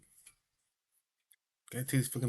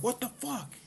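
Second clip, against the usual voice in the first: imitated freak-out; 12 semitones higher, 6 dB quieter than usual. Friend jumps up swatting at his arm, mock freak-out. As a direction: mimic a friend jumping up in alarm. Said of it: "he's gonna freak out".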